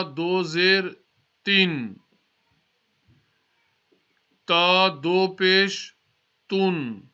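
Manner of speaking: slow, clear recitation with long pauses for students to repeat; slow spelling drill. Shown as a demonstration. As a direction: spell each syllable out slowly so the class can follow. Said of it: "try to read it slowly".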